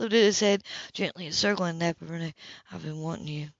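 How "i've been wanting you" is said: low, soft confession; a soft, hushed admission; quietly. A narrator's reading. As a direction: say it quietly, close to her ear. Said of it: "he said quietly".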